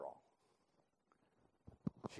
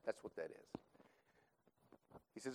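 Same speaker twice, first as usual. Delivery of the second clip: ordinary delivery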